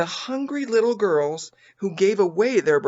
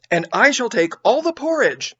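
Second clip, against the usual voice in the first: higher and louder eager outburst; bursting in; 6 semitones higher, 5 dB louder than usual. A narrator's reading. Narrator bursting in to give her porridge. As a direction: blurt it out, ashamed and eager. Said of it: "I burst in".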